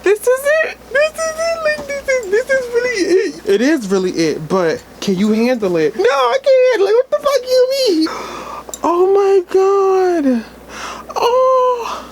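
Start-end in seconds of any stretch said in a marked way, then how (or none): 0.0-3.5 very high, cracking, teary voice; tearful disbelief. Real, not acted. Overwhelmed by the film's ending. 6.0-12.0 very high, teary half-laugh; laughing through tears. Real, not acted. Overwhelmed by the film's ending.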